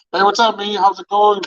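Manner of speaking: fake-casual hello; mock friendly greeting. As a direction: greet him like nothing's wrong.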